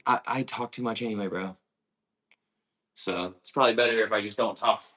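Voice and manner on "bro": normal talking voice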